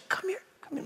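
tiny, high, very soft beckoning; sweet grandma. Retold or imitated. Sweet grandma calling grandson down to her, hushed high beckoning. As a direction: coax someone closer in a hushed little voice. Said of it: "she was so sweet 'cause she's like".

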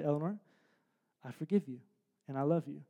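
put-on soft, gentle father to his little girl; hushed and tender, reassuring her; tender forgiveness. Father forgiving daughter after discipline, softly and tenderly.

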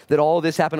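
ordinary delivery

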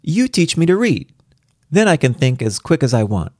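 put-on high, eager child; a small child insisting; little girl voice. A narrator's reading. Child demanding lessons.

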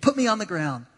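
loud, high mock surrender; giving up. Ball carrier begging to be tackled, loud mock giving up. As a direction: cry out a hammed-up surrender. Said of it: "just kinda give up".

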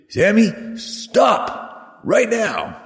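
high, drawn-out escalating command; insistent parent calling. Retold or imitated. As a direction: call after a child who ignores you, stretching each word. Parent repeats order as child ignores.

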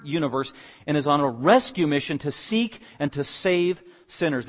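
loud, rising gospel proclamation; preaching at full force. Acted. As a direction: declare it to the back row. Declaring the gospel, climax.

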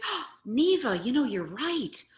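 bright, high, easygoing agreement; owning it with a laugh in the voice; cheerful agreeing reply. Shown as a demonstration. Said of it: "What I could say is".

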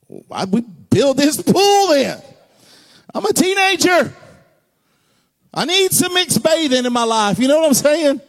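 high, loud indignant protest; mock teen outrage. Preacher imitating his teenage self, high and indignant. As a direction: play the frustrated teenager.

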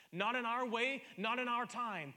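loud rising preacher's cry; ringing pulpit declaration; preaching crescendo. Real, not acted. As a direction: lift it up and proclaim it. Preacher building to climax.

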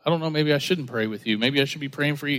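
low, soft, quick imitated aside; hesitant backing off. Visitor reluctant to pray with sour-faced leader, hesitant murmur.

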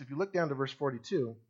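normal talking voice

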